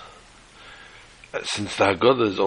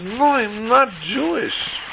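much higher and very slow, patience wearing thin; slow, higher, exasperated stranger; 12 semitones higher, much slower than usual. Acted. Stranger answering the old lady a second time, slow and exasperated. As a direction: drag it out, barely holding his temper.

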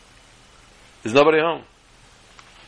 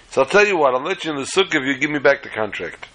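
ordinary delivery

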